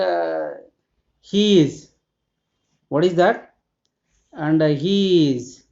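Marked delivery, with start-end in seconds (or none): 1.2-1.9 "he is" stretched out, slightly raised; prompting. Shown as a demonstration. Teacher cues learners to find the pronoun, prompting. 4.7-5.6 slow, stretched-out "he is"; prompting. Shown as a demonstration. Teacher repeats the example for learners, slow prompting.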